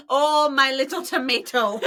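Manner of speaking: slow, laughing, sing-song Italian lilt; a light Italian accent slipping into a cute phrase; a touch of Italian accent while laughing; unintended Italian accent. Retold or imitated. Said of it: "Just become, like, Italian".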